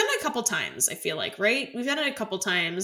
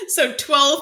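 ordinary talk

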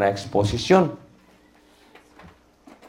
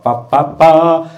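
pa pa climbing up and louder; rising vocal drill; 5 semitones higher, 6 dB louder, much slower than usual. Shown as a demonstration. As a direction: push the last pa out loud and high. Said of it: "hacer ejercicios vocales". (ejercicio vocal que sube)

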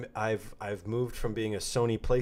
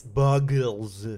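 put-on louder German-accented narrator voice; Werner Herzog; 2 semitones higher, 7 dB louder than usual. Co-host asks for a word in the voice, German-accented impression. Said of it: "Say Bargles in that voice".

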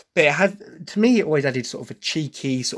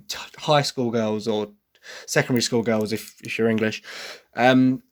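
normal talking voice

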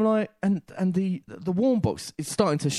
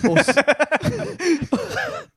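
laughing; 5 dB louder, much slower than usual. Retold or imitated. Half-asleep wife, laughing retelling.

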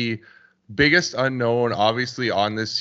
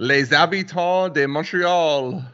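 put-on French accent; 7 semitones higher, slower than usual. Announcing team with French flair.

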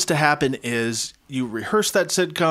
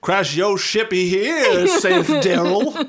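mock archaic; 4 semitones higher, 5 dB louder, much slower than usual. Mock archaic Daryl line.

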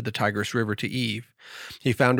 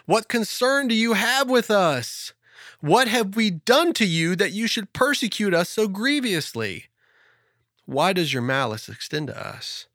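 high, slow pleading lament; lamenting Eve; 8 semitones higher than usual. Acted. Eve crying out at the devil, slow high pleading lament. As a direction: plead to the heavens, stretched out. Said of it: "She cried out".

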